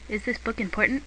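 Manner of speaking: usual speaking voice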